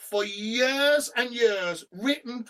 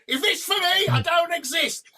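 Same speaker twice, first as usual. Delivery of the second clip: higher, louder lively pub quote; higher animated line; 7 semitones higher, 3 dB louder than usual. Retold or imitated. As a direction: toss it off loudly to the bar. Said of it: "And so".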